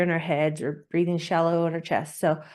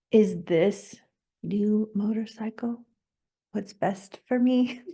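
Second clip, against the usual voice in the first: asking oneself; 3 semitones higher, slower than usual. Acted. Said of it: "then just ask yourself".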